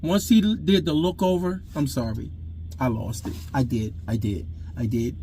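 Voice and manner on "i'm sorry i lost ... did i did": low, soft, regretful confession; hushed, low-pitched apology; subdued admission. Real, not acted. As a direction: drop the voice right down and own up quietly. Owns up to losing his temper.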